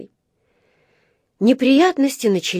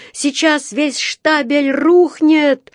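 higher, slower alarmed shout; urgent yell; 7 semitones higher, 3 dB louder, much slower than usual. A narrator's reading. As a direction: keep yelling the danger. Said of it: "заорал мастер".

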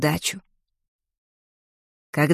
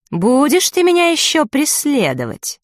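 mocking; 7 semitones higher than usual. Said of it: "словно издевается".

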